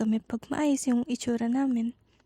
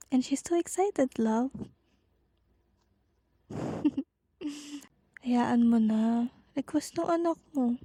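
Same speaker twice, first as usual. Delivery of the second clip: usual speaking voice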